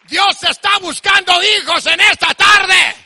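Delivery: high, slow, shouted proclamation; full-force preaching. Real, not acted. Preacher telling congregation God sees them as sons, shouted proclamation. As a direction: stretch the phrases out at full shout.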